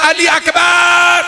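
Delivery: keening sermon shout; lamenting call. Acted. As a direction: call each name out like a cry of grief. Imam calling out to the young men.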